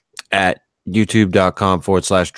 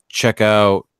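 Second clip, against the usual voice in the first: ordinary delivery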